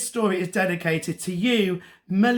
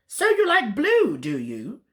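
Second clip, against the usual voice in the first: taunting wizard; 5 semitones higher than usual. A narrator's reading. Wizard punishes captured princess with washing-up, tauntingly. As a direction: taunt her as the wicked wizard.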